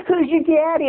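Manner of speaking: high-pitched mocking kid bully; bully's taunt. Evil kid Sammy needles the boys at camp, high taunting.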